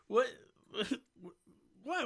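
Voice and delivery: normal talking voice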